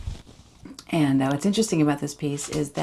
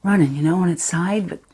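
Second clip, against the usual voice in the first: put-on a distraught fifteen-year-old girl, louder and rising as the memory takes over; Charity, shaken teenager; 5 dB louder than usual. Teen girl confides the fox's death to Aaron, haunted and urgent. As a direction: tell Aaron about the fox, close to breaking. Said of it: "this is Charity from Sam Holcroft's Dancing Bears".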